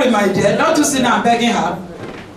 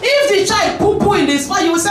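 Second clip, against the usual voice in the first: ordinary talk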